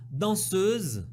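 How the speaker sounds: danseuse with the eu sound drawn out; stressing the E. Shown as a demonstration. Teacher modelling the eu in danseuse for students. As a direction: lean on the eu in danseuse. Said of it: "et bien insister sur le E".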